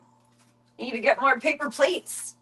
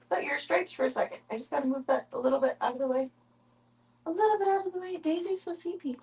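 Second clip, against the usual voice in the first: pet talk; 8 semitones higher than usual. Real, not acted. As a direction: coo up high, gentle and hushed. Talking to her cats.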